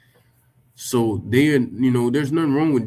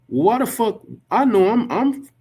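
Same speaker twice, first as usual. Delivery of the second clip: high, sharp disbelief; incredulous outburst; 10 semitones higher, 3 dB louder than usual. Real, not acted. Reacting to the quote, in high incredulous disbelief. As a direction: blurt it out in disbelief.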